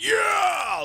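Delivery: high, loud rock cheer. Retold or imitated. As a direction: shout it like a metal fan. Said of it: "threw the horns a lot and went".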